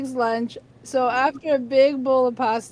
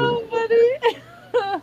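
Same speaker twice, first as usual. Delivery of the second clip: much higher and slow, words breaking into laughter; real laughter; 11 semitones higher, much slower than usual. Real, not acted. Woman laughing about her friend's crazy haircut, words dissolving into laughter. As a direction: laugh it out, barely getting words through.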